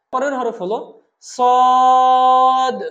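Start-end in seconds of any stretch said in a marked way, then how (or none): 1.2-2.8 সদ loud and long, bounced final d; pushed final sound. Shown as a demonstration. Teacher models the letter, সদ long with pushed ending. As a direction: hold সদ level, then knock out the d. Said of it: "একটু ধাক্কা দিয়ে আমরা উচ্চারণ করব".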